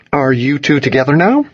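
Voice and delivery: high, probing rise at the end; pointed question. A narrator's reading. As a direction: ask it with a knowing lift. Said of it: "he said pointedly".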